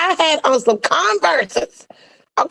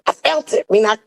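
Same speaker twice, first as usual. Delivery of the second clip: ordinary delivery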